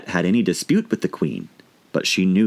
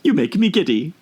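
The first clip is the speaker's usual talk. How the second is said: higher, peevish queen's complaint; irritated queen; 9 semitones higher than usual. A narrator's reading. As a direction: complain like a dizzy tyrant. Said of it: "screamed the queen".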